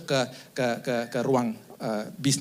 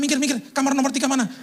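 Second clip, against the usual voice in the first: high, pushy hurry; mock frantic rush; 11 semitones higher, 3 dB louder than usual. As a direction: shove past everyone, pitched up. Rushing through hospital, pushing past. (terburu-buru mendesak, suara tinggi)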